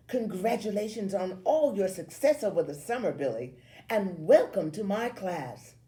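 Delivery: high, loud congratulatory teacher voice; gushing teacher. A narrator's reading. Homeroom teacher welcoming Billy back, gushing. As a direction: welcome him grandly before the whole class.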